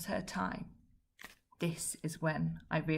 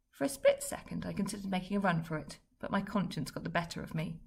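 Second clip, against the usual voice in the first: ordinary delivery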